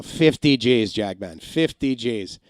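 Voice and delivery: slow, drawn-out Russian accent impression. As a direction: drag out the catchphrase in a Russian accent. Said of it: "throws that up there".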